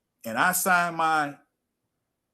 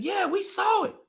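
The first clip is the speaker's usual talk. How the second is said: cousin's voice. Retold or imitated.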